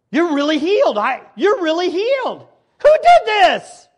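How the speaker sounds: high, loud amazed cry; hammed-up amazement; astonished. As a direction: gasp out the wonder. Astonished priests voiced.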